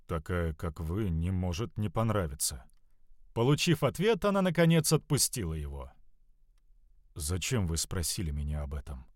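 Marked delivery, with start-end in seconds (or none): 0.1-2.7 lower, soft, embarrassed answer; a hint of a flustered youth; shy young man. A narrator's reading. 7.2-9.1 lower, soft, embarrassed answer; a hint of a flustered youth; shy young man. A narrator's reading. The youth asks.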